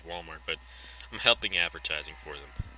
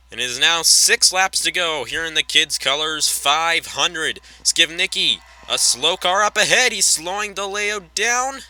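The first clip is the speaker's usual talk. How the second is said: raised, driving race commentary; live race play-by-play; 9 semitones higher, 8 dB louder, slower than usual. Pretend. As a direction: call the lead battle like a track announcer. Calls the lead battle.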